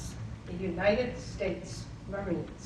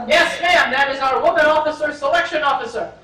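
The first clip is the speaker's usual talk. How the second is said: louder, fast military phone answer; brisk sergeant; 3 semitones higher, 11 dB louder, faster than usual. Retold or imitated. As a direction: snap it off like a drill-sharp recruiter.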